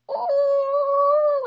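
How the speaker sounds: long loud high-pitched "oh"; held-in frustration escaping as a drawn-out squeal; exasperated high wail. Retold or imitated. Mom seeing the syrup in the sink, exasperated wail. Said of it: "just trying to stay calm".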